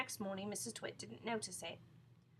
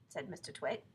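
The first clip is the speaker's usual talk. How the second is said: ordinary delivery, nothing marked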